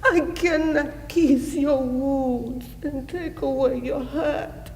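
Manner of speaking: high, tearful anguished plea; anguished grief. Acted. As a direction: plead in grief, voice lifting towards tears. Mother grieving over her dying son.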